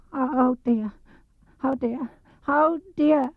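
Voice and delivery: high, slow, worried Piglet voice; fretful Piglet. Acted. Piglet nearing the trap, high frightened fretting. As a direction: let the fear squeak through. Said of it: "said Piglet to himself".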